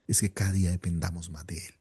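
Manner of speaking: ordinary talk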